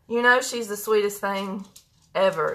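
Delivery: ordinary talk, nothing marked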